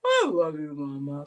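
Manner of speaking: low mock dog howl; dog-voiced demo. Shown as a demonstration. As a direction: mimic the dog's moan. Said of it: "Oh, say".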